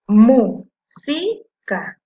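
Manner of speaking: "música" loud and very slow, in three chunks; syllable by syllable. Shown as a demonstration. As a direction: punch out "mú", "si", "ca" separately. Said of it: "Y ahora la separé en sílabas".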